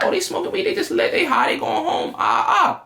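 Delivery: very high, squeaky put-on voice; mocking inner voice. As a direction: make fun of them in a silly high voice. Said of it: "so I'm like".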